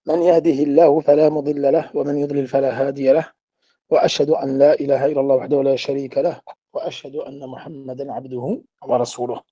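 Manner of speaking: rapid, low Arabic recitation; formal opening invocation. Real, not acted. (invocation récitée vite, à voix basse et grave)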